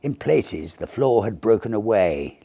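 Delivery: usual speaking voice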